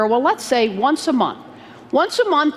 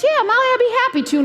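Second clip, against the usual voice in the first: quick, high-pitched eager yes; mildly hammed-up obliging friend; eager agreement; 11 semitones higher, much faster than usual. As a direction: rattle off a happy yes, pitched up. Friend readily agrees to favor.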